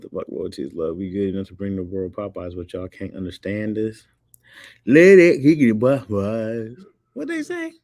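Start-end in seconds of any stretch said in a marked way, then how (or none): 4.9-6.8 loud, higher mock Southern shout; big hollered impression; imitated catchphrase. Imitates hard-to-understand Popeyes line. 7.1-7.7 very high squeaked question; comic falsetto confusion; mock bewilderment. Acts baffled listener.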